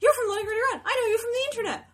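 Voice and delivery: high, loud imitated fan voice; amazed excited fan. Fan amazed to find her in a Brooklyn bar, excited. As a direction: gush in disbelief at spotting someone known online.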